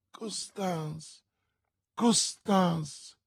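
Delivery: high, grieving call to a lover; stunned cry, rising from soft; anguished exclamation. A narrator's reading. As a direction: call the name twice, faint then stronger. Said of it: "esclamò D'Artagnan".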